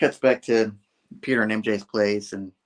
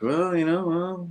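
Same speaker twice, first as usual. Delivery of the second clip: high, slow wine-sipping drawl; slow smug drawl; 8 semitones higher, slower than usual. Retold or imitated. Harry drinking wine and conceding to Gwen, slow high drawl. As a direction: stretch it out smugly. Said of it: "He's like".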